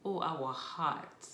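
ordinary talk, nothing marked

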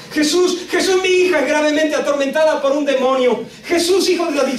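high, urgent cry for help; imitated shouting. Woman begs Jesus for her tormented daughter, imitated shouting. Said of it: "ella empieza a gritar".

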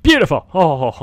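high, loud delighted cry of "Beautiful!"; excited cry. Real, not acted. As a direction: burst out in delight. Admiring a torch-lit build.

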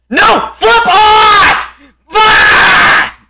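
high, loud crying shout; anguished yell. Real, not acted. Gamer wailing at the game after falling. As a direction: wail it at the game.

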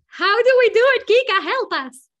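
teacher playfully begging a colleague for help; mock desperate plea. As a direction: wail for rescue, half joking. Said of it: "mock plea for help".

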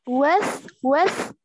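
with said with a clear th ending; teacher's model word. Shown as a demonstration. Teacher shows the student how to say with, carefully. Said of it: "Từ này mình đọc là with".

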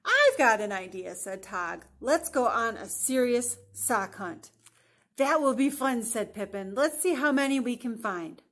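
animated picture-book narration with emphatic key words; lively storybook voice; story reading to children. A narrator's reading. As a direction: read it out expressively for young listeners. Reads picture book aloud.

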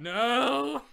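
long, high, loud no; acted refusal. Jekyll refusing to come to Hyde, loud protest.

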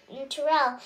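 plain reading voice

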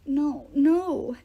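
lightly voiced whimpering protest. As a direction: plead like a scared big kid. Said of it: "Tyson whimpered".

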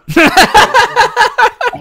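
sudden loud, high burst of laughter; big cackling laugh. Real, not acted. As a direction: crack up loudly, pitched high.

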